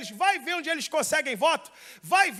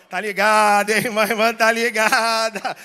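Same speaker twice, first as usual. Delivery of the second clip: loud, drawn-out mock preacher voice; exaggerated parody of Pentecostal cadence; 4 semitones lower, 9 dB louder than usual. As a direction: imitate a comic revival preacher, stretching the words. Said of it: "O que imitava o".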